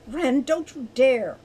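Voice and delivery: loud, slow warning; furious warning. A narrator's reading. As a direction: warn sternly, each word weighted. Falcon near a fit, warning Wren.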